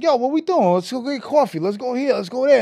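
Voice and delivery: high-pitched excited rallying; rally a new buddy, pitch up; eager invitation. Retold or imitated. Retold eager invitations to hang out.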